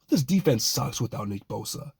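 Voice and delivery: ordinary talk, nothing marked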